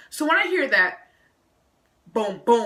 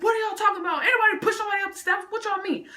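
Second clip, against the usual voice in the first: high, fast indignant questions; retold exasperation, pitch shooting up; mad and upset; 13 semitones higher, faster than usual. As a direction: fire back high-pitched, baffled questions. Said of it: "So now I'm mad. I'm just upset".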